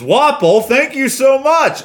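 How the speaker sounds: high, loud gushing impression; squealed, drawn-out gratitude; excited thanks. Acting out the birthday man's thanks.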